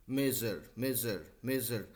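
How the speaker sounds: मेजर slowly with a zh between ज and स. Shown as a demonstration. Teacher repeating the word for a student to copy. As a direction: say मेजर with the middle sound between j and s. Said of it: "दोनों के बीच का है वो".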